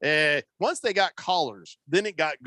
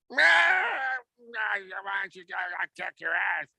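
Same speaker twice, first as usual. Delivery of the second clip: put-on nursing home old-timer; 4 semitones higher than usual. Co-host imagining the pair in a nursing home, mock old-man squabble. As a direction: play a feuding old codger, hammed-up. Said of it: "that'll be us at the, uh, nursing home".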